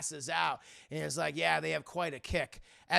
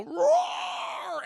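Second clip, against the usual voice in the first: huge, much higher roaring shout; monster roar; 8 dB louder than usual. Retold or imitated. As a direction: bellow it out like a beast. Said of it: "we hear this monster go".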